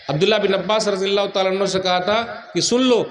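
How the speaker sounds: usual speaking voice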